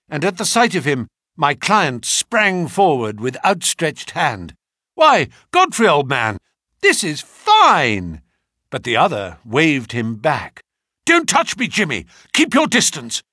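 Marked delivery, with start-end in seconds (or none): 5.0-8.2 loud, delighted cry of welcome; joyful greeting. Acted. Client greets long-lost friend. 11.1-13.2 loud, alarmed, keep away; urgent warning. Acted. Godfrey recoiling from friend.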